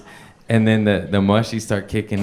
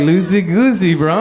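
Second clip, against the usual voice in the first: higher, louder chirpy echo; stoned driver parroting the coach; mock bro; 7 semitones higher, 4 dB louder than usual. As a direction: throw his own words back at him. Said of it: "I'm like".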